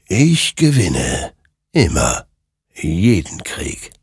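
slow, deliberate otherworldly claim; drawn-out sinister pronouncement; ominous creature. A narrator's reading. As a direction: savour each word slowly. The creature claims every victory.

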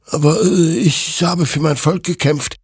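high, hesitant protest; defensive justification. A narrator's reading. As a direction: justify yourself anxiously. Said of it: "rechtfertigte sich Hector".

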